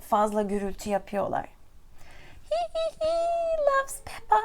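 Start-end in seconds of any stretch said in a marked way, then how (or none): 2.5-4.4 very high-pitched little giggle; Peppa giggling. A narrator's reading. Teacher reads Peppa's laugh to learners, high giggle. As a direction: squeal out a tiny tittering laugh. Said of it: "laughs Peppa".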